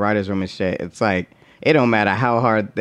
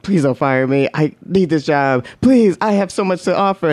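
pleading employee; 7 semitones higher than usual. Retold or imitated. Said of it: "he's like".

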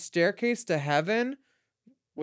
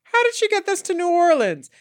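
amazed; 12 semitones higher, 8 dB louder than usual. Real, not acted. Amazed at the costume.

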